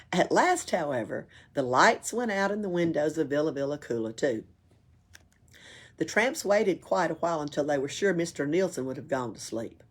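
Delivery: plain reading voice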